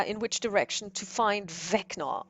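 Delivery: ordinary talk, nothing marked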